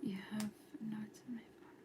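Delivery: very soft, mostly voiceless; whisper. Real, not acted. Streamer reading while others sleep, whispered. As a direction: whisper under your breath.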